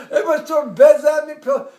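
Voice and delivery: ordinary delivery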